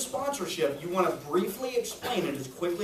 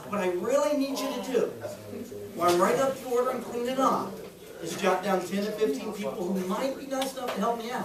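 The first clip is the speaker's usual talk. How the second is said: pleading; 4 semitones higher than usual. Shown as a demonstration. Rep asking client to jot names, pleading. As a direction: plead gently for the favor. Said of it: "almost like a plea".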